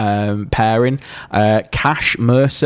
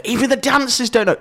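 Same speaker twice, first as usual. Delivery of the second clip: much higher mock amazement; mock audience suspense; 12 semitones higher than usual. Podcaster repeats the show's big secret, high mock amazement. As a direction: imitate a breathlessly hyped viewer. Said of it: "you want people to go".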